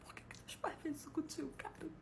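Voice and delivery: stunned whisper to the audience; incredulous whisper. Real, not acted. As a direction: breathe it out in disbelief. Disbelief at the name.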